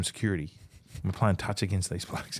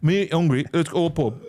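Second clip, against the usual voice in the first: loud, higher-pitched mock Wigan dialect; Wigan accent; 6 semitones higher, 4 dB louder than usual. Teammate mimicking how Wigan players speak, Wigan accent. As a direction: put on a broad Wigan lad voice. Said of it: "Wigan are speak".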